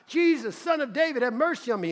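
high, loud desperate plea; crying out. Retold or imitated. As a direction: call to him with everything. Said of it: "he's crying out".

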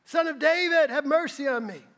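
loud, high desperate cry; calling out. A narrator's reading. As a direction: call out to him over the crowd. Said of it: "And he called out".

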